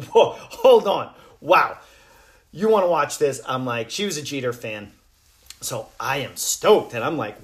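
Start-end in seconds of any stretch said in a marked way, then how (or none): 0.1-3.4 loud, high, slowed-down whoa of disbelief; hammed-up amazement at his wife's wish; surprised delight. Reacting to wife wanting documentary.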